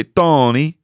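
Tony said with a Swedish lilt; Swedish accent. Retold or imitated. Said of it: "she would say".

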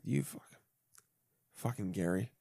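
muttered curse. Retold or imitated. Muttering about fellow passenger.

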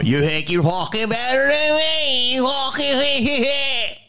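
very high, screechy Delco heckling; slow, high-pitched mock Philly tirade; Delco accent. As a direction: cut them down in a hammed-up Delco accent. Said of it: "That's how I grew up".

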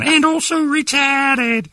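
put-on very high, slow botched Australian accent; badly faked Aussie, stretched out; hammed-up awful Australian impression; [name]. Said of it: "I'd make her add on".

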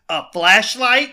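imitating a let-down kid; mock disbelief. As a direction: draw it out as a kid's incredulous question. Kid scorns his cheap present.

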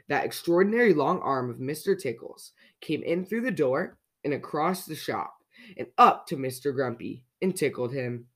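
usual speaking voice

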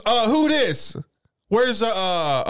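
high-pitched, questioning phone voice; imitated woman caller. Woman asking who answered, imitated caller voice. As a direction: mimic a suspicious woman caller.

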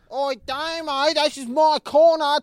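very high, drawn-out broad Aussie holler; Aussie crackhead. Acted. Telling Damo to leave the corner, Aussie crackhead. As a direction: yell it as a strung-out Aussie window washer. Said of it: "I went in as an Aussie crackhead washing windows".

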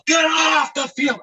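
man yells the heard order out of the dugout; shouted repeat. Retold or imitated. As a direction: shout it back, mocking the groundskeeper. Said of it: "I go".

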